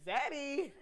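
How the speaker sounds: "Zaddy" starting with a buzzed Z; Z sound. Shown as a demonstration. Co-host offering another word for daddy, "Zaddy" with a Z. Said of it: "With a Z".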